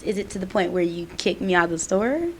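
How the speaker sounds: normal talking voice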